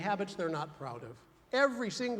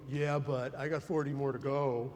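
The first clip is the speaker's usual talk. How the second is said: deflecting reply; 6 semitones lower than usual. Retold or imitated. Deflecting a compliment.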